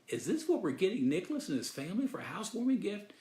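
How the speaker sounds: high, fast, puzzled disbelief; dumb incredulous question. Retold or imitated. Husband to wife in Walmart, high fast baffled question. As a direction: blurt it out, baffled by the bucket. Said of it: "I said something really dumb".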